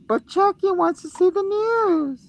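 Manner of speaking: put-on high, slow childish doll voice; squeaky little doll begging; mock kid doll pleading; Chucky doll voice.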